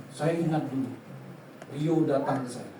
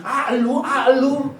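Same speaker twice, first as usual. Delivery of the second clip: loud, higher "hey you" holler; raised, familiar street-style shout; casual calling out; 5 semitones higher, 6 dB louder, slower than usual. Acted. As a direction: call out rudely like to an old buddy. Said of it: "Masih gitu cara ngomongnya?".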